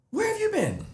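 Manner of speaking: Cece's voice. Retold or imitated. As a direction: put on her innocent question.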